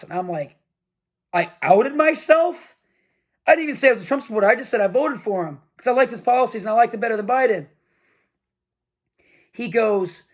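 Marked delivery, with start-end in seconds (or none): 1.3-7.7 a student stunned at being called out, protesting in disbelief; incredulous retort. Retold or imitated. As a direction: push back, stunned he'd say that. Retorting to professor's accusation.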